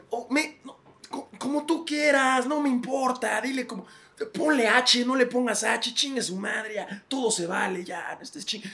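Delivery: high, whiny, fed-up father voice for the academy; the Spanish academy as an exhausted dad; tired RAE as dad. Acted. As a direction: whimper it like a sleepy dad pestered by questions. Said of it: "es lo mismo".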